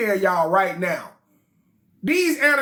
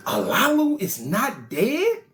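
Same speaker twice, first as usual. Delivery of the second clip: solemn reading; slower than usual. A narrator's reading. Sages ask, read.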